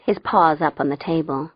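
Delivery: plain reading voice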